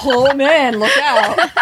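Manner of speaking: high, loud, laughing tease. Real, not acted. Teasing a bandmate fan at the table, laughing. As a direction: say it through real laughter. Joking about the band Kix.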